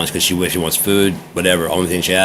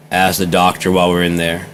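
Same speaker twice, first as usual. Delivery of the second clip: normal talking voice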